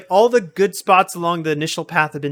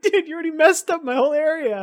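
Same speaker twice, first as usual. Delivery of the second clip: high mock complaint; 12 semitones higher than usual. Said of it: "I was like".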